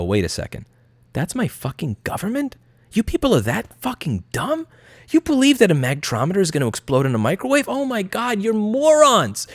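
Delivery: disbelieving viewer, pitched high; mock disbelief. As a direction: gasp at how dumb they are, climbing high. Viewers reacting to government.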